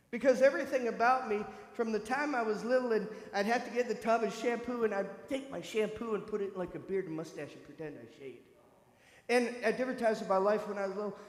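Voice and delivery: normal talking voice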